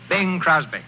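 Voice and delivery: ordinary talk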